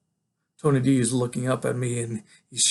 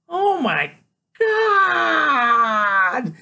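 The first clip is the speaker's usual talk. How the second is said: high, loud, long-drawn shriek; wild high-pitched kid whoop; 13 semitones higher, 6 dB louder, much slower than usual. A narrator's reading. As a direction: shriek it out and stretch the last word. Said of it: "he's shrieking and laughing and whooping it up so loud".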